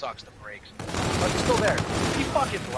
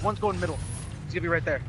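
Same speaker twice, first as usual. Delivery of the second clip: normal talking voice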